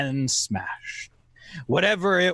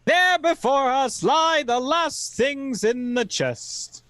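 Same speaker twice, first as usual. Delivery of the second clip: story narration; 11 semitones higher than usual. A narrator's reading.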